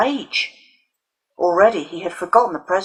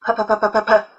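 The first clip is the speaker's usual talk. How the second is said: jeering p-p-p-p-p-p-p, a touch higher; mocking chorus; 4 semitones higher than usual. A narrator's reading. The other boys jeering at Alison's stutter. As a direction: boys chanting the stutter to taunt. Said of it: "a ragged chorus of".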